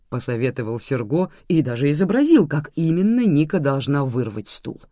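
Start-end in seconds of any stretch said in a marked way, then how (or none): none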